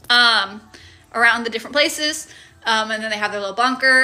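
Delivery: ordinary delivery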